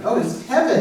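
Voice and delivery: laughing as it clicks; laughing recognition. Real, not acted. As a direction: laugh out the name, amused. Realizing the author.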